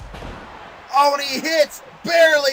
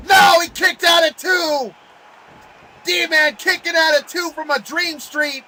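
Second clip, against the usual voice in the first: excited shout; 3 semitones higher than usual. Real, not acted. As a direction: yell the kick-out to the crowd. Surprise kick-out at two.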